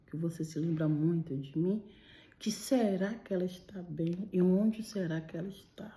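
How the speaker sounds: the dead friend whispering reassurance from beyond; whispered tender message. Retold or imitated. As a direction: breathe it gently like a loving spirit. Dead friend's spirit speaks tenderly.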